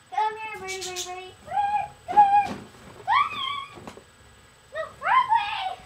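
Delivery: high sweet calling voice; coaxing a wild bird. Real, not acted. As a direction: coax the birdie in, high.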